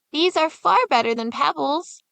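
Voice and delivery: put-on a boy thrilled by treasure; delighted boy. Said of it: "said Hansel".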